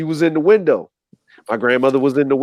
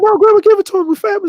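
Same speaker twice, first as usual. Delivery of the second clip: put-on high young voice begging grandma; childlike plea; faster than usual. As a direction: beg sweetly in a kid's high voice.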